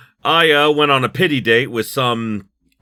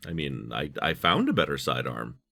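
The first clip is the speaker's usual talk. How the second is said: normal talking voice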